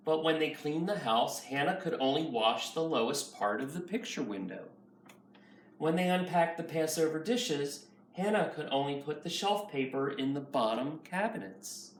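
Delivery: ordinary delivery, nothing marked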